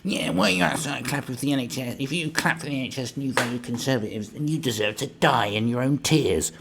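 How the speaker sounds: high, whiny mocking voice; mocking a hater. Mocking the social media haters in a whiny voice. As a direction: sneer it like a smug online hater. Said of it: "you see them on social media going".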